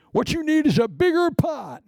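high-pitched mocking voice; mock a know-it-all up high. Mock advice to pot dweller.

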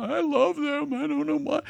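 high, dreamy mock gush; smitten churchgoer. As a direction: play someone melting with sudden affection. Mimics smitten churchgoer melting.

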